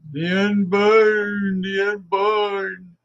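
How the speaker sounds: high, slow mock cry; drawn-out high mock wail; mocking old zealot. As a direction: ham up the pious old extremist. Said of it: "he used to say".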